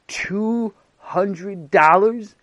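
slow, drawn-out disbelieving question; a teacher's astonished echo of the price; surprised. Acted. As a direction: stretch it out in disbelief.

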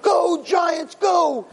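high cheering shout; fan cheering. Retold or imitated. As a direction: yell for the team like a fan.

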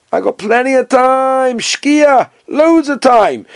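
high, slow, breezy brush-off; hammed-up carefree debtor; mock unbothered borrower. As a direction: stretch it out like he has all the time in the world. Nakdimon brushing off the creditor.

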